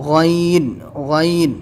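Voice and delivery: "غین" gargled from the throat against the palate; throaty letter demo. Shown as a demonstration. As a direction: draw out "غین" from the throat for learners. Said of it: "لیکن آواز حلق سے آئے گی".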